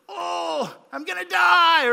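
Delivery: high, loud hammed-up moan; melodramatic whine. Esau back from the field moans, hammed-up loud and high. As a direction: ham up dying of hunger. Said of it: "he's just like".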